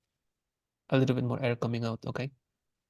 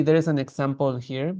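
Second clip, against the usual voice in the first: ordinary delivery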